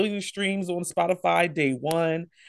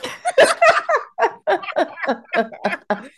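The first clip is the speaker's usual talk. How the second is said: high burst of laughter; loud high laugh; 13 semitones higher, 6 dB louder than usual. Real, not acted. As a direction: cackle loudly. Laughing at imitation.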